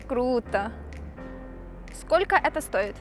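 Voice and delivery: usual speaking voice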